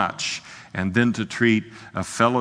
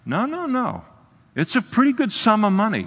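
emphatic protest; 11 semitones higher than usual. Real, not acted. Correcting the dismissive view.

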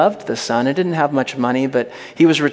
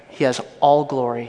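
ordinary talk, nothing marked

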